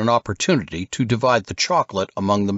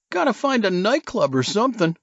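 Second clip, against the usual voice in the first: high, lazy surfer-dude voice; stoned surfer dude; 12 semitones higher than usual. Acted. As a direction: play the bloodshot California surfer. Casey leaving.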